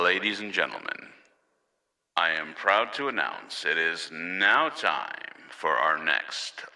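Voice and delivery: ordinary talk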